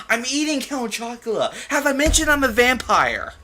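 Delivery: high, loud mocking taunt; mock the wannabe vampire; hammed-up sarcastic ribbing; sarcastic mockery. Real, not acted. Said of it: "mocking the author sarcastically".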